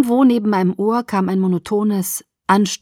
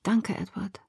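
whisper; 8 dB quieter than usual. A narrator's reading. Exhausted girl thanking Edward.

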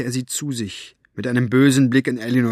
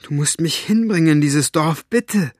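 higher, urgent plea, jumping up on the last word; shrill with fear; 5 semitones higher, 4 dB louder than usual. A narrator's reading. Maggie begs Staubfinger to take her to the village, frightened. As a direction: beg anxiously, voice climbing. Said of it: "Maggies Stimme wurde schrill vor Angst".

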